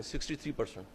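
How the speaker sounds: a dropped-voice echo of sixty three percent; quiet repeat. Real, not acted. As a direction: let sixty three percent sink in with a hushed, low echo. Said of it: "repeated figure for emphasis".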